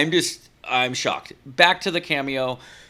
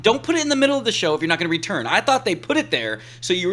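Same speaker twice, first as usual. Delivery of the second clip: real worked-up rant, louder and higher; heated fan rant, fast at the end; fired up; 6 semitones higher, 6 dB louder than usual. Real, not acted. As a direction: argue it like it personally offends you. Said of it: "Sorry, I'm fired up".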